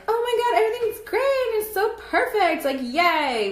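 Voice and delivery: high, chirpy mock cheer; fake cheerful. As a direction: sell a fake perfect life, overly bright. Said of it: "pretend that".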